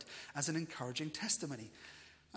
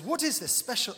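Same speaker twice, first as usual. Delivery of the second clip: put-on heavy Russian accent, higher and louder; hammed-up Russian spy; a defector's thick Russian accent; 8 semitones higher, 9 dB louder than usual. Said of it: "I'm gonna try not to do a Russian accent".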